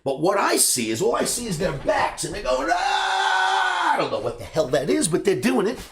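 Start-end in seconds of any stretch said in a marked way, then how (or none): 2.7-3.9 high shouted "Ah!" from the pit; traders' scream. Retold or imitated. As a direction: let out one sharp trading-pit yell. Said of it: "a circle of men screaming".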